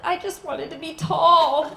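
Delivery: loud mock wail of a little girl; hammed-up childish lament; crying child. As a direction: imitate a kid's tearful complaint. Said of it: "Now she's crying".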